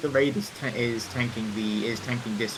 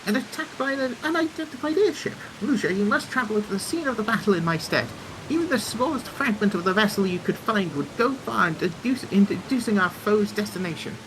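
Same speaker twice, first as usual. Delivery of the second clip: higher, theatrical engineer voice; earnest, self-assured engineer; confident engineer character; 9 semitones higher than usual. Acted. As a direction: play an inventor laying out a plan. Voices engineer giving a quest.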